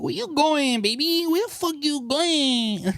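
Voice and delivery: hammed-up controlling man; mock jealous boyfriend. Imitating a jealous boyfriend stopping his girlfriend leaving, high and hammed-up. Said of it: "what if we did this to a man".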